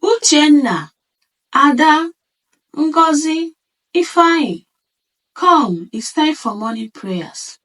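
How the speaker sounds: high, loud call across the house; mother calling her children from the bedroom to pray. A narrator's reading. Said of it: "she called out from her bedroom".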